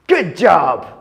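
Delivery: usual speaking voice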